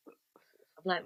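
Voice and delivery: barely voiced, hushed whisper; stunned whisper. Retelling a surprise meeting with an old school rival, stunned whisper. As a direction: whisper it as if lost for words.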